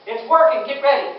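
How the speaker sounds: loud, urgent heads-up to the crew; firm warning. Retold or imitated. Senior man warning the crew, urgent and loud. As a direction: warn them the fire is real.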